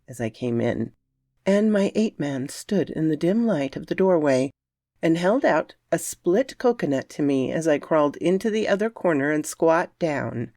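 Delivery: plain reading voice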